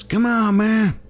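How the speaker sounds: put-on high, breathy old-man Biden voice; hammed-up Biden "come on, man"; Biden impression. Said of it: "Biden says".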